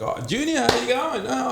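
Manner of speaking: high-pitched imitated hello; imitating a chummy high-voiced greeting; mock cheery greeting. Imitating everyone's usual greeting to him.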